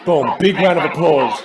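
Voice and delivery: loud, slow applause call; rally the crowd to clap, loudly; celebratory cheer. Real, not acted. Cheering after the played clip.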